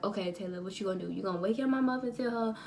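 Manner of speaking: soft worried murmur to yourself; quiet self-talk. Retold or imitated. Scared self-talk at night.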